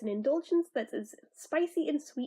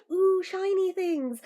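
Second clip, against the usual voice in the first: higher, slow, dazzled by shine; mock magpie; 6 semitones higher, 4 dB louder, slower than usual. Joking to viewers about loving glitter, in a playful dazzled voice. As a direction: play the girl distracted by shiny things. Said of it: "I'm a little miss".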